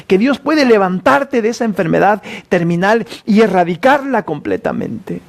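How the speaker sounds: high, urgent sermon voice; fiery preaching. Acted. Preacher urging the congregation to be bold, fiery preaching. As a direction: push them to bold faith.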